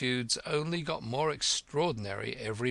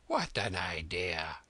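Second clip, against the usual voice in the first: whispering; 6 semitones lower, 3 dB quieter, slower than usual. A narrator's reading. King scoffs in whisper.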